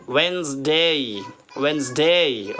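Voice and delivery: वेडनेसडे as a drawn-out "wenz-day", first D dropped; silent D. Shown as a demonstration. Teacher repeats for learners to copy, slow silent-D वेडनेसडे. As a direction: slowly model वेडनेसडे as two syllables, "wenz-day". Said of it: "तो हम पढ़ेंगे".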